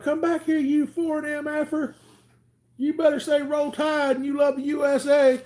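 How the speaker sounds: high, loud furious shout; angry chase yell. Retold or imitated. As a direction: scream at him while chasing.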